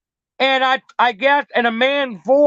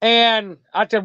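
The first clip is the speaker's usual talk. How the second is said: ordinary delivery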